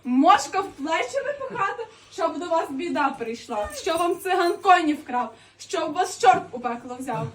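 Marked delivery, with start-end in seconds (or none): 0.0-1.9 laughing indignation. Acted.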